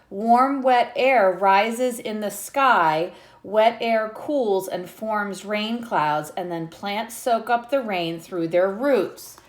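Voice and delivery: lightly lilting read-aloud; reading a picture book to children. Acted. Teacher reading the book to her class, lightly lilting.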